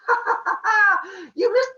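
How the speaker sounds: very high loud taunting cackle; high shrill mock laughter. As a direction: laugh in his face. Brother mocking missed spear.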